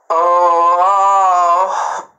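loud gasping oh; surprised gasp. Real, not acted. Gasps at a comment.

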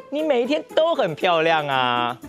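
plain reading voice

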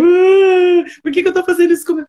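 mock despair at her own hair; exasperated outburst. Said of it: "Aí eu". (desabafo alto e exasperado, acelerado)

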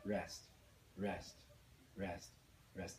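low, soft, evenly spaced words; hushed rest count. Shown as a demonstration. Teacher marking silent beats after playing, hushed counting. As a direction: murmur each rest on the beat.

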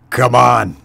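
angry commander bellowing. Acted. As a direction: bellow an order at cowering troops. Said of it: "he bellowed".

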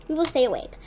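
ordinary delivery

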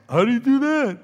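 high, loud, put-on dopey asking; mock clueless question. Mocking someone who won't figure it out, high and clueless. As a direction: mimic a clueless whiner loudly. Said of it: "when you say".

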